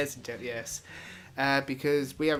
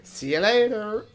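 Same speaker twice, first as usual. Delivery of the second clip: loud cheery farewell; 7 semitones higher, 9 dB louder, slower than usual. Acted. Loud outro.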